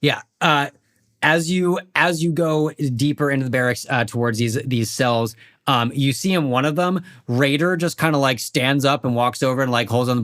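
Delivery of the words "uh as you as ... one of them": usual speaking voice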